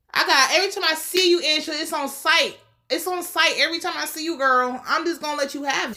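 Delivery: high-pitched playful warning; hammed-up indignant threat; mock threat. Mock threat addressed to Angela.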